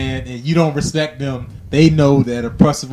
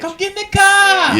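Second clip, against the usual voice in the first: put-on high slow Boston accent; mock Boston guy, drawn out; 5 dB louder than usual. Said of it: "a Boston accent".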